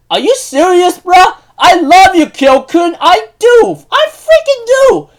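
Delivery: very high, loud, incredulous protest; hammed-up exasperated outburst. Fan answers Kyo in Tohru's place, high-pitched exasperated outburst. Acts out outraged love protest.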